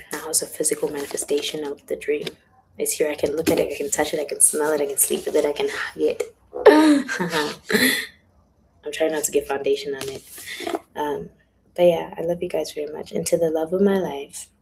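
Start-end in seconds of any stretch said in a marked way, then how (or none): 6.5-8.1 high, loud burst of laughter; laughing. Real, not acted. Speaker gushing over hugging her dream, laughing loudly. Laughs after saying she can hug it.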